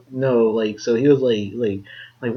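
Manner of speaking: ordinary talk, nothing marked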